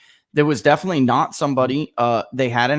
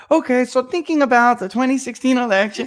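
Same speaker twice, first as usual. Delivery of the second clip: imitating a pollster's scripted question, higher; a hammed-up, higher call-center interviewer reading the script; 8 semitones higher than usual. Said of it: "'cause he's like".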